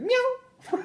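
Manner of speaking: very high squeaky cat meow; mock meow. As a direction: meow like a kitten as the baby's name. Baby named as a noise.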